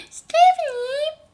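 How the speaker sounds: very high, loud kiddie whine; squeaky cartoon cousin. Imitating annoying little cousin's complaint, squeaky cartoon voice. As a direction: squeal it like an animated cartoon child. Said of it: "that's literally what she sounds like".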